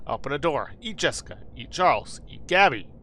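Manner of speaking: ordinary talk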